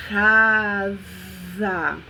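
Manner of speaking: casa stretched and blended slowly; casa pulled out as one long word; drawn-out word. Shown as a demonstration. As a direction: blend casa back together, long and slow. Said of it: "ao identificar esses sons".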